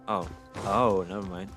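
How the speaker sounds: ordinary talk, nothing marked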